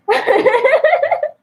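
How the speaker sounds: high, loud burst of laughter. Real, not acted. Host cracking up at own bit about songs, loud laughter.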